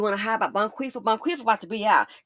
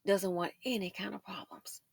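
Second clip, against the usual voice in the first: whispered repeat, no voice at all; 10 dB quieter than usual. Shown as a demonstration. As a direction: breathe the line as a secret aside. Whispered repeat of previous line.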